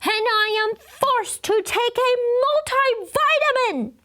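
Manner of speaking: put-on high, loud outraged child shout; high indignant kid holler. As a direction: holler it at the teacher. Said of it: "he shouted real loud".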